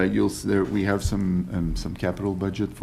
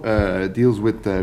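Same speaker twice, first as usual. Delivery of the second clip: usual speaking voice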